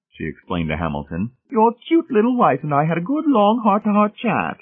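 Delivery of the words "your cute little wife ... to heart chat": very high, gushing matron voice; high, effusive society lady. Acted. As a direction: gush about your chat, pitched sky-high.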